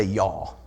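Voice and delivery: "Y'all" drawled low and falling, like yawl; saying y'all. Shown as a demonstration. Preacher leads the church in saying y'all, drawled low. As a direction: model "Y'all" slow and low for the room. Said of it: "turn to your neighbor and say".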